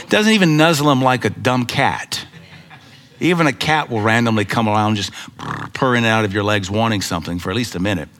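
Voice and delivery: ordinary talk, nothing marked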